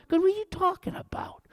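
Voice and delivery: high whispered scoff; mocking whisper. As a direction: whisper the ridicule in disbelief. Mocking ridicule of the doctor.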